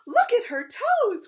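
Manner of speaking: loud, high, drawn-out exclamation; delighted cry. A narrator's reading. Queen adoring her baby's toes, delighted cry. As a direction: cry out high and slow in adoration. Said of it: "cried the queen".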